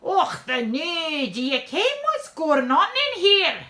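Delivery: loud, high, demanding Scots inspector; blustering comic Scottish policeman; broad Scots accent. A narrator's reading. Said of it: "demands in his broad Scots accent".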